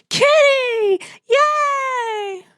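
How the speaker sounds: high, gleeful cat-lover squeal; squealing with delight. Retold or imitated. Cat lovers squealing at a big kitty, high and gleeful. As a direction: squeal like you just spotted a kitty. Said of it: "So we would be like".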